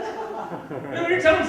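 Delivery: laughing through the words; breaking into a laugh. Real, not acted. Comic laughing at his own point.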